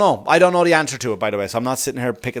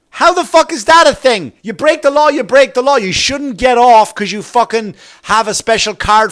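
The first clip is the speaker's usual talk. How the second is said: loud, high-pitched indignant tirade; real anger, voice raised and hammering each repeat; outraged rant; 9 semitones higher, 8 dB louder than usual. Real, not acted. Comedian railing at special cards for cops' friends, outraged.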